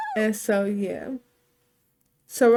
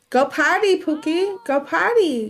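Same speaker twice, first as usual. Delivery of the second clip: higher, louder coaxing command to the dog; urgent coaxing call; 6 semitones higher, 5 dB louder than usual. Real, not acted. Owner urging her dog outside, raised coaxing call. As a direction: call it up high and loud, pleading.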